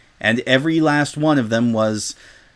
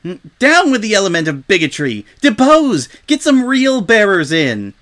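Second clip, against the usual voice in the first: higher, louder protest yell; rallying cry; 8 semitones higher, 6 dB louder than usual. Acted. Crowd pony rallies the mob against Rainbow, yelling. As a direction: rouse the mob against her.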